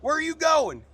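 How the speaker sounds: high, loud hollering buddy; excited guy. Retold or imitated. A guy hollering at his friend, high and loud. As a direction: call out to a buddy heading off. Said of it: "So some guy goes".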